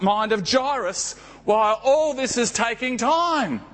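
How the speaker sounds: usual speaking voice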